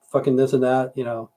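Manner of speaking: ordinary talk, nothing marked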